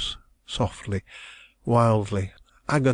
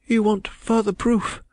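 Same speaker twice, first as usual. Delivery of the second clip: put-on distressed young woman; 12 semitones higher than usual. A narrator's reading. Young woman protesting her love, high and anguished. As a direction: cry it out as the wronged heroine. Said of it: "she hurriedly gasped".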